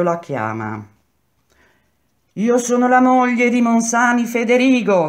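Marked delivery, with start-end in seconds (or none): 2.3-5.1 high, loud roll-call cry; woman shouting. A narrator's reading. Wife announcing herself to jailed husband, shouting. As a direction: call out so the whole prison hears. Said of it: "gridò la stessa voce".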